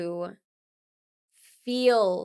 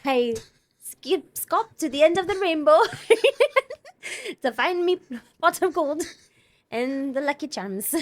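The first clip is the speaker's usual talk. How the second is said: higher, louder mock Irish-Scottish lilt, laughing through it; a wobbly Irish-turned-Scottish accent, higher and louder, with laughs; Irish accent slipping Scottish; 7 semitones higher, 6 dB louder than usual. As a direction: ham up an Irish boy chasing the rainbow, laughing. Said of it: "That's Scottish".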